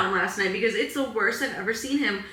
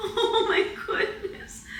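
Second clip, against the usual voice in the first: high, slow, breathy laughing sigh; overwhelmed laugh while tearing up; teary laugh; 10 semitones higher, much slower than usual. Real, not acted. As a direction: laugh through rising tears. Tearful laugh at dog.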